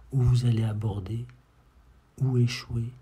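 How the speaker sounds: ordinary delivery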